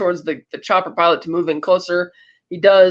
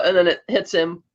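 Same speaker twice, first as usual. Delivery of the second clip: normal talking voice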